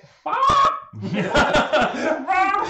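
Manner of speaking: very high, loud chicken squawking. Acted. Player voicing Freya the chicken, loud high squawk. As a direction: squawk like a startled hen. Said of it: "Says Freya the chicken".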